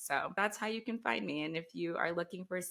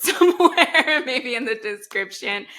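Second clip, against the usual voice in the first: laughing; 7 semitones higher, 13 dB louder than usual. Real, not acted. Laughing request to host.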